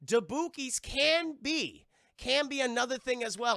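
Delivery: hammed-up website twist; dramatic announcement. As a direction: proclaim it, spacing out each word. Reads dramatic twist from page.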